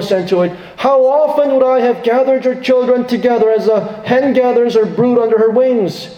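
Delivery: high, longing lament for Jerusalem; impassioned lament. Acted. Jesus grieving over Jerusalem's children, high longing lament. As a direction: plead like a mother hen refused. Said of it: "Notice what he says".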